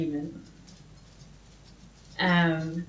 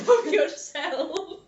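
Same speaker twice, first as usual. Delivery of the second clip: laughing; 8 semitones higher, much slower than usual. Real, not acted. Mocking own old message.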